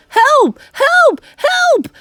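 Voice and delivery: very high, loud cries; comic shrieks of panic; screaming. A narrator's reading. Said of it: "the man was screaming".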